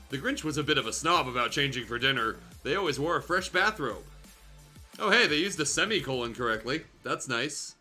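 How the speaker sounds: normal talking voice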